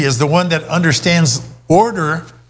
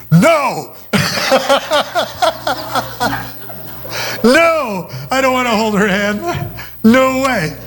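mock refusal; 8 semitones higher, slower than usual. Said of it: "And I'm thinking".

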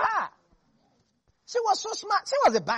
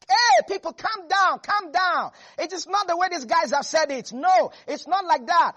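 louder and higher, urging calm; Caleb calming the crowd; 6 semitones higher, 5 dB louder than usual. Acted.

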